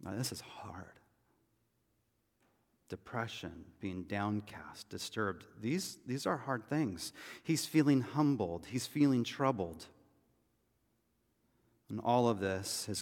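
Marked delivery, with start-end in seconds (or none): 0.0-7.1 breathy near-whisper, slow; hushed. Real, not acted. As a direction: lean in and drop to a hush. Pastor turns to depression.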